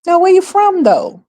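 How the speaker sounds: high, sharp questioning voice; pointed mock question. Vlogger acting out quizzing a self-styled Brit, high and pointed. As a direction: press them where they're really from. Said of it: "They Brits".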